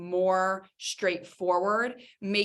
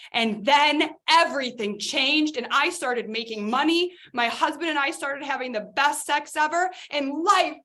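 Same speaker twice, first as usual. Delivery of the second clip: higher, louder hammed-up success story; mock testimonial gushing; 6 semitones higher, 3 dB louder than usual. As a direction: build a parody sales testimonial to its triumph. Parody of gushing marketing testimonial.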